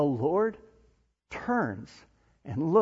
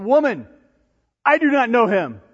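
loud, raised-pitch acted denial; emphatic denial; 7 semitones higher, 8 dB louder than usual. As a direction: deny it forcefully to an accuser. Acting Peter denying it to the girl.

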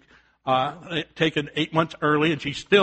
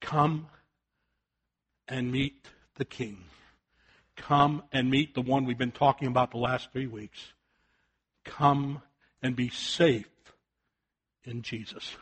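soft, much quieter pleading call; preacher softly inviting listeners to Jesus; gentle invitation; 5 semitones lower, 9 dB quieter than usual. Acted. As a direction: draw them in gently, almost hushed. Tender altar call invitation.

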